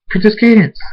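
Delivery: high, loud call with falling end; screamed name. A narrator's reading. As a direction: scream out to find the princess. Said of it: "Thunderstorm screams".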